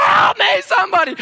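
high, loud cry for help; yelling for help. Retold or imitated. Drowning man calling anyone on the beach, loud and high. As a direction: throw the call out across the water. Capsized, yelling toward shore.

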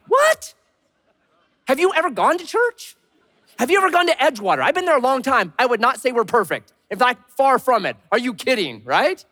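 can't believe they think church is perfect; incredulous. Retold or imitated. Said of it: "I'm like".

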